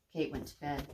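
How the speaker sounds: plain reading voice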